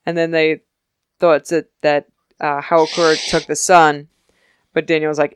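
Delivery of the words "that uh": ordinary talk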